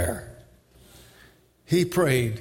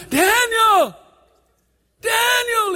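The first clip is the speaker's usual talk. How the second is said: high, loud anguished cry; frightened holler; 7 dB louder, slower than usual. Acted. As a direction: holler out, desperate for an answer. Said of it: "And he hollered".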